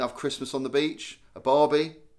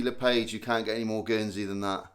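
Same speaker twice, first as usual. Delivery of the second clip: ordinary talk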